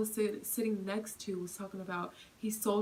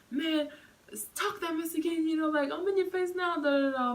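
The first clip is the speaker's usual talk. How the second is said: high, loud mock yelling; retold challenge; 9 semitones higher, 7 dB louder than usual. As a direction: get in his face and shout. Man confronting from the door.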